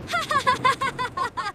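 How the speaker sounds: fast, delighted laughter; laughing. Acted. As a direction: giggle with pure glee. Delight while bouncing around.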